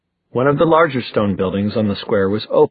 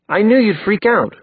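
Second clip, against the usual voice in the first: high-pitched defensive retort; exasperated, higher-pitched comeback; 11 semitones higher than usual. Acted. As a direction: toss it back defensively, pitch jumping up.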